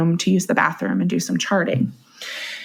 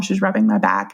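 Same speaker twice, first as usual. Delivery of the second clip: ordinary delivery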